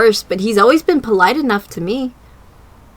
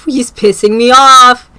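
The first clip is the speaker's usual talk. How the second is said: louder, slow irritated complaint; real irritation; annoyed; 6 dB louder, slower than usual. Real, not acted. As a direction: vent at the screen. Annoyed streamer.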